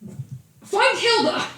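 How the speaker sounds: high, loud yelling order; furious yell. Acted. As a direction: bellow it at the servants. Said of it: "he yells out to his retainers".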